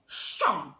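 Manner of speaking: loud, defiant refusal; spirited refusal. A narrator's reading. Toad defies the Badger's order, with great spirit. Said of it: "replied Toad with great spirit".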